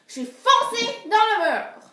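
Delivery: high, loud dramatic declaration; big mock-heroic announcement; hammed-up boast. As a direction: proclaim the feat loudly, voice leaping up. Hammed-up tall tale climax.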